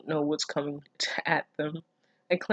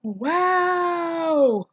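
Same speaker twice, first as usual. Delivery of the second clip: long high drawn-out wow, much louder; stunned wow; 12 semitones higher, 6 dB louder than usual. Real, not acted. Reader shocked at what her character has become, loud high wow. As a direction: let the disbelief stretch the word high.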